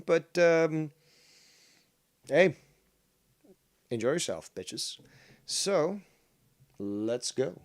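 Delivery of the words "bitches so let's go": ordinary delivery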